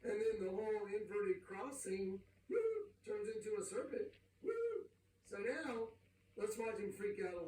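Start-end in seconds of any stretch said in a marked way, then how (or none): 2.5-2.6 high-pitched whooping sound effect; high woo. Acted. Preacher showing a cross turning into serpent, high woo. 4.4-4.9 high-pitched whooping sound effect; high woo. Acted. Preacher repeating the transformation effect, high woo.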